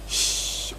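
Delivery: soft voiceless shush; hush. Real, not acted. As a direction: shush everyone so he keeps sleeping. Hushing near sleeping dad. (fısıltıyla susturma)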